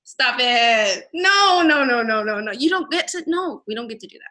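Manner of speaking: loud, high, exasperated pushback; loud, high, fed-up rant; heated retort. Retold or imitated. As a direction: push back hard and refuse to back down. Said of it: "and I'm like".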